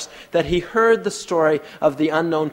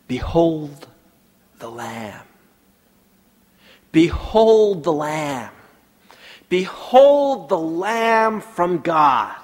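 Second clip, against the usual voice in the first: drawn-out, reverent preaching; solemn preaching climax; slow intoned proclamation; 4 semitones higher, 4 dB louder, much slower than usual. Acted. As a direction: let each "Behold" ring slowly.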